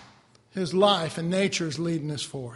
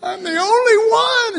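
high, loud self-pity; high loud mock lament; 13 semitones higher, 9 dB louder than usual. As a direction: wail like a lone prophet. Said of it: "I remember doing and going".

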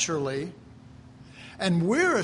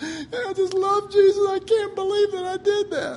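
imitating crying; 13 semitones higher than usual. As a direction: act out a distraught person crying in the pastor's office. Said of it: "they just broke down crying and said".

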